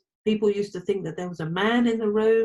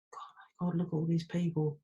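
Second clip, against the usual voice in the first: nervous whisper; 7 dB quieter than usual. Retold or imitated. Nervous inner thought before crowd.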